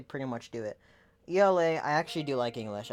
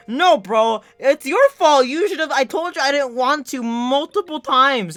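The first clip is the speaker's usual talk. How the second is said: loud, high blaming rant; indignant yelling; 10 semitones higher, 12 dB louder, slower than usual. Retold or imitated. Storyteller blaming the kid who goaded him, indignant yelling. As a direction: hammer the blame home, much louder. Retold argument, blaming the classmate.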